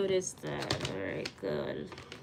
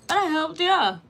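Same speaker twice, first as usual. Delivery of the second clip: playful; 7 semitones higher, 6 dB louder than usual. Real, not acted. Playful punchline.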